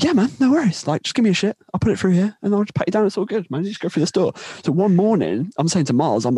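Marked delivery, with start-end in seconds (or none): 0.0-4.3 high-pitched, casual agreeable voice; easygoing guy. Retold or imitated. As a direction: wave them through, pitched well up. Acted easygoing Norwegian guy agreeing.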